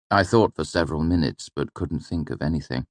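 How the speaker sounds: plain reading voice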